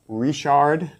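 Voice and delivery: ordinary talk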